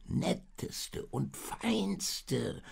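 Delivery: high, drawn-out bleating sheep voice; high-pitched storybook sheep. A narrator's reading. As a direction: gush on slowly as a bleating sheep. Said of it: "blökte das Schaf weiter".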